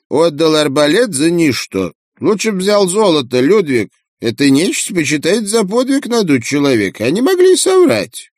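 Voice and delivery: high squawky pelican voice; old pelican voice. Acted. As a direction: squawk it like a grumpy old bird.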